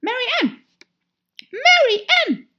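very high, loud character yell; calling out. A narrator's reading. Rabbit outside summoning Mary Ann, high shouted call. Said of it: "rief die Stimme".

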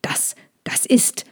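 halting, whispery stammer; lost for words. A narrator's reading. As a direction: splutter and break off, speechless. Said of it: "der Drachenältesten fehlten die Worte".

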